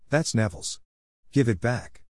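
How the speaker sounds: ordinary delivery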